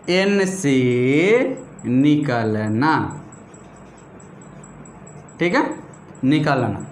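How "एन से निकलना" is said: slow, stretched-out words; drawn-out dictation. Shown as a demonstration. Teacher giving students the second rule, drawn-out dictation. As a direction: draw out एन से, pause, then a long, low निकलना.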